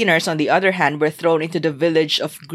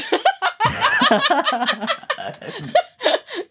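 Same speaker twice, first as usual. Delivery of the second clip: long high laugh. Real, not acted.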